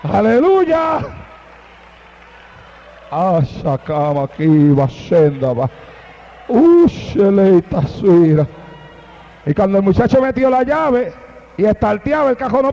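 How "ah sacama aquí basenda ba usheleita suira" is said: low, drawn-out ecstatic tongues; murmured glossolalia; speaking in tongues. Real, not acted. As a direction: pray in tongues, deep and slow.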